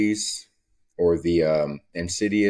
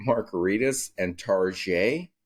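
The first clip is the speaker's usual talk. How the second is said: mock excited shopper; 3 semitones higher, slower than usual. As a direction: drag it out like a thrilled shopper.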